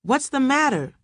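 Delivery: high, urgent question; alarmed questioning. A narrator's reading. Servants burst in on the screaming, alarmed questioning.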